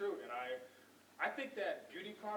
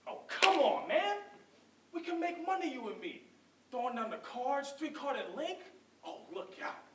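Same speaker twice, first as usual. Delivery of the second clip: loud, high pleading opener, then animated pitch; eager hustling Booth; 6 semitones higher, 4 dB louder than usual. Acted. Booth urging his brother to join him, eagerly. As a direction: sell the scheme with pushy, lively urgency.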